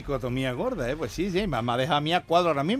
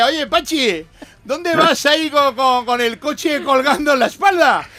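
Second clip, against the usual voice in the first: Basque passerby; 8 semitones higher, 7 dB louder, slower than usual. Acted. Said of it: "te dice".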